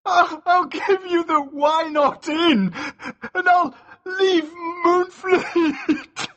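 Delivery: high-pitched desperate bargaining; shaking voice. A narrator's reading. Mascu bargains with his captor, shaking and desperate. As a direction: squeal out the offer, close to tears. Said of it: "his voice shaking".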